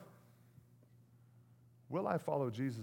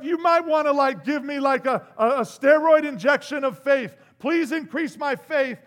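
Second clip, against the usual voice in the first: nervous plea; 10 semitones higher, 13 dB louder than usual. Retold or imitated. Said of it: "I'm kinda going".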